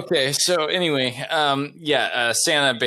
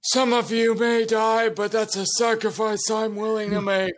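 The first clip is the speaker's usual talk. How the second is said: commander speech; 10 semitones higher than usual. Acted.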